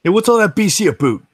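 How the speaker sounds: high, loud, hammed-up Canadian accent; bad Canadian impression. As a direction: lay the Canadian accent on thick for a joke. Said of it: "worst Canadian impression".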